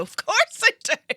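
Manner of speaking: very high, loud, slow mock-certain voice; fake sure answer. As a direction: fake confidence to save face. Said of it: "But I'll always go".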